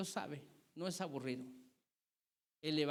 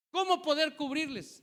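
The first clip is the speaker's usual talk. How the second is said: higher and louder, pressing the question; pleading preacher's cry; 8 semitones higher, 6 dB louder than usual. Real, not acted. Preacher pleads with the church about the youth, calling out loud. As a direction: cry it out to the congregation.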